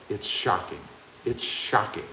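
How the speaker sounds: low, soft dramatic tease; hushed teaser. Acted. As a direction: drop the voice as if sharing a secret. Teasing the upcoming exhaust video.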